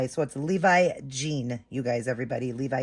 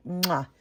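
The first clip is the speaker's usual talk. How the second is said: kiss sound. Real, not acted. Collector kisses her new doll with a smacking kiss.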